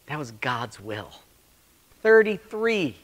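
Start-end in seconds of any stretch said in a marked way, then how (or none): 2.0-3.0 "Thirty-three" punched higher and louder; emphatic number. Real, not acted. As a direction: hit "Thirty-three" hard. Emphatic repeat of age.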